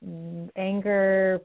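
ordinary talk

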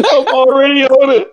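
high, crying with laughter; words squeezed through laughter; laughing squeal. Real, not acted. Hosts cracking up over the hammer picture, squealing.

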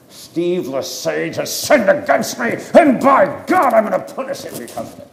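loud, high mock rant, speeding up at the end; hammed-up angry vow of revenge; acted vengeful outburst. Man vowing payback on one who wronged him, mock furious.

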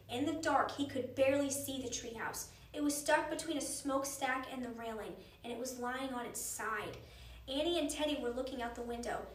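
plain reading voice

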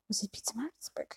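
very soft whispered question; hushed aside to oneself; whispered self-check. Real, not acted. Checks the name with herself.

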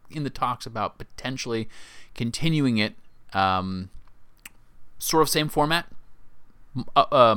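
normal talking voice

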